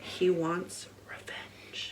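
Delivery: hushed trailer-voice whisper; dramatic whisper. Pretend. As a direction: breathe it like a movie trailer.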